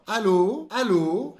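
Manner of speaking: "Allô" pushed loud on the last syllable, rising; stress on final syllable. Shown as a demonstration. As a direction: model "Allô" with the weight at the end. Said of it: "a sílaba tônica fica no final".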